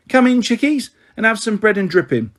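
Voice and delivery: a hint of a cheery, homely mother calling the children in; motherly welcome. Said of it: "she said".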